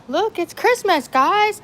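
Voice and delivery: high, bright call to the dogs; rally the dogs for Christmas; excited call. Real, not acted. Calling dogs.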